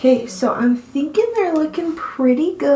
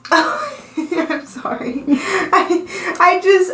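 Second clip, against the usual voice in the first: laughing; 5 semitones higher, much slower than usual. Real, not acted. Laughing after old skin joke.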